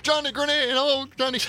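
very high-pitched mock fan squeal; imitated excited callers. Teenage callers greet the radio persona on air, imitated high excitement.

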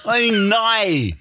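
high, slow Australian "No"; imitating an Australian saying no; Australian accent. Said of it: "Australian people".

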